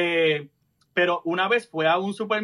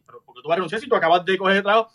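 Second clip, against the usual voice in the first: fast stepmother; much faster than usual. Retold or imitated.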